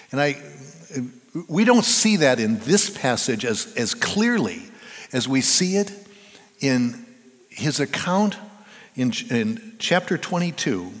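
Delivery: ordinary talk, nothing marked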